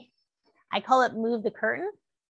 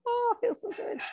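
delighted squeal; 14 semitones higher than usual. Real, not acted. Stretch feels good, squeal.

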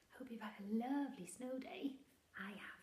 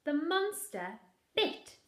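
slow, loud, higher build-up; dramatic narration; 10 semitones higher, 16 dB louder, slower than usual. A narrator's reading. Narrating the monster biting the father, dramatic. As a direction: draw it out for suspense.

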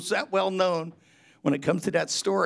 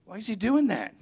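higher, mildly indignant mocking question; indignant Pharisees; 7 semitones higher, 3 dB louder than usual. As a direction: mimic the outraged Pharisees. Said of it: "they were really".

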